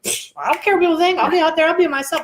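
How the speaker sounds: high, quick, dismissive mock bravado; mock defiance. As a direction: imitate someone breezily brushing everyone off. Mimics people claiming not to care.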